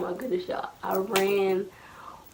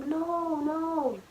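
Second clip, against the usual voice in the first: retold whine; 7 semitones higher than usual. Child denying to dad, acted.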